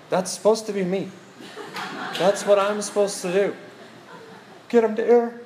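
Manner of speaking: high-pitched comic protest; mock protest. Husband watching wife go first, high mock protest.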